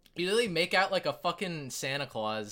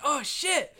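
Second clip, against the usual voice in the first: surprised; 9 semitones higher than usual. Acted. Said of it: "She's like".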